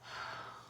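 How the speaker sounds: long, soft exhaled sigh; weary sigh. Real, not acted. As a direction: let out a quiet, breathy sigh. Venting about failed relationships.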